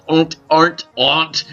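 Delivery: stumbling over AREN'T in a weird way; odd pronunciation. Real, not acted. Player catches himself saying it weirdly, odd pronunciation of AREN'T. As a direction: try AREN'T repeatedly, each coming out oddly. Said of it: "that was a weird way I said that".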